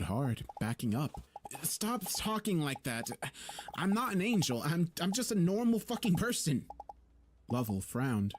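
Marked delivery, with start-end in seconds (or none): 1.6-6.7 desperate frightened denial; panicked protest. Acted. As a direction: push back in rising panic. Narrator panicked, refusing angel role.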